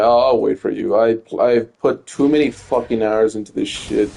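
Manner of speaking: ordinary talk, nothing marked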